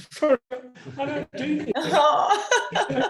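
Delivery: half laughing, half crying; teary laugh. Real, not acted. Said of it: "I'm getting all teary".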